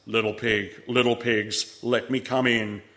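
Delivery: the big bad wolf calling; deep wolf voice. A narrator's reading. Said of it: "the familiar deep voice".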